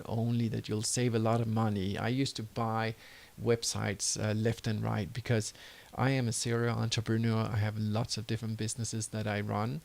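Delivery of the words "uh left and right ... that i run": normal talking voice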